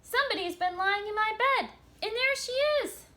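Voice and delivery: high, loud excited squeal; little bear crying out. Acted. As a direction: cry out in alarm as the tiny bear. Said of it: "But then he cried".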